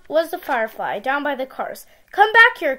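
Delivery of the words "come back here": loud high call. Acted. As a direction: call out after him. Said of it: "called Sam".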